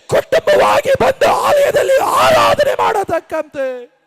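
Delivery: impassioned preacher, voice building to a shout; fervent preaching. Real, not acted. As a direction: preach it with rising force. Preaches at full force. (ಆವೇಶದ ಬೋಧನೆ)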